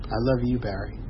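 retold mother's quiet affection; soft reply. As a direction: give the words back quietly.

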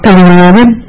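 imitating a bus intercom call; bus driver on the intercom. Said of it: "he comes on the intercom".

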